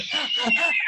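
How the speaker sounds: a sharp, rising gasp for air; imitated gasp. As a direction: gasp like you can't breathe. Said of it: "like, going".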